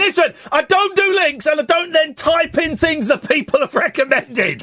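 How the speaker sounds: high, loud exaggerated rant; hammed-up shouting at himself; mock shout. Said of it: "Me say".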